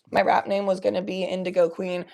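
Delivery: ordinary talk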